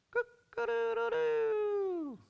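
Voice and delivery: very high, drawn-out cock-a-doodle-doo; loud falsetto rooster imitation; rooster crow. Imitates the rooster crowing on cue.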